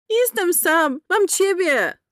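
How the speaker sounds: put-on high, young boy's voice. A narrator's reading. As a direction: voice the small boy, pleading. Boy voiced in a story.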